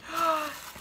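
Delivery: sharp gasp of delight. Real, not acted. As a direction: gasp like you just saw a treat. Seeing stickers.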